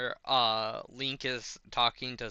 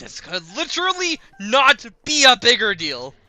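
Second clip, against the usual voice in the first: much higher and louder cry; freaked-out Purah; 12 semitones higher, 16 dB louder than usual. Retold or imitated. Purah answering Link's shrug, a loud high cry. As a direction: exclaim in disbelief. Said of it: "It's like".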